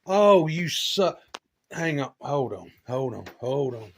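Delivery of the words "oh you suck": mock envy. Jealous of friend's truck.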